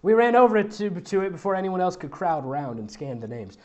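ordinary delivery

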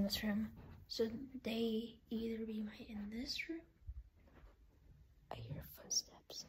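soft hushed whisper; whispering while hiding. Real, not acted. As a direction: whisper so nobody hears. Whispering while hunting hiders.

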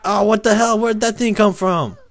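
high, loud shocked protest; real alarmed exclamation; startled outburst. Real, not acted. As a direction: yelp it in disbelief. Caught off guard in game.

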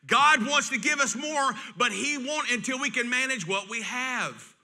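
slow, emphatic preaching. Acted. Pastor urging his church, slow and emphatic. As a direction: press each word to the congregation.

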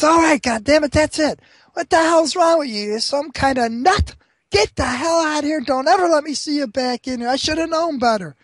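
high-pitched angry rant; acted outrage. Barkeep kicking out the blind customer, acted angry outburst. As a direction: throw the troublemaker out in a fury.

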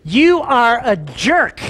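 mock telling-off, word by word; blunt retort. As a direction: spell out the insult slowly, half-joking. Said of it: "I wanted to say".

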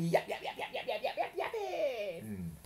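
high laughing yap yap; yapping puppy. Acted. Narrator mimics the puppy, high laughing yaps. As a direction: yap like a little dog through giggles.

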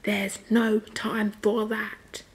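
very slow, stretched-out put-on voice; drawn-out emphasis. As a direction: stretch every word of "There's no time for that". Repeats line for comic emphasis.

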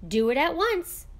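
firm command. A narrator's reading. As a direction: insist it be done right now.

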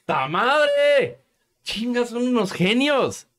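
high-pitched mock admiration; ironic, wonderstruck praise; sarcastic amazement. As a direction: exclaim it high, hailing them as geniuses. Sarcastically praises the senator's idea.

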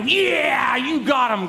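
high, drawn-out imagined cheer; triumphant cheer. Acted. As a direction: cheer it as if you finally won. Acts out gloating cheer to God.

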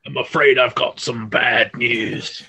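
slow, drawn-out mock announcement; Bad News Barrett. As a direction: imitate a wrestler's catchphrase, stretching each word. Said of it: "storyline with Bad News Barrett".

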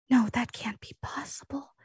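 panicked secret whisper into a phone; hushed, breathy disbelief. Acted. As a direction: whisper it urgently, as if hiding the call. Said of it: "like, whispering into her other phone".

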